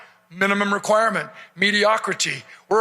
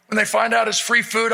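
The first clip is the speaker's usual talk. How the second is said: normal talking voice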